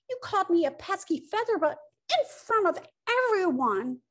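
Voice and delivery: high, hammed-up tearful protest; wounded wail. Woodpecker humiliated before everyone, high indignant wail. Hurt woodpecker wails back at bear.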